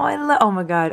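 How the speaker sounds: normal talking voice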